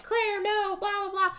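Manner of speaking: high, frantic pleading with a ghostly sister; panicked pleading. Retold or imitated. Soldier freaking out at his sister, panicked high cries. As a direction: cry out in terror at his sister. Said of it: "he's freaking out".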